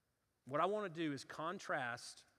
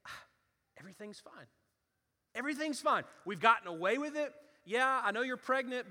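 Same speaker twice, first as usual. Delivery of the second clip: smug relief; 7 semitones higher, 9 dB louder than usual. Acted. David's smug inner voice acted.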